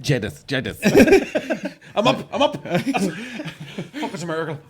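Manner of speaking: high, slow, laughing miracle cry; high laughing pretend patient; laughing mock revival. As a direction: gasp awake through laughter.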